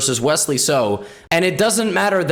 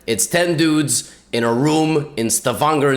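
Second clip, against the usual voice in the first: ordinary talk, nothing marked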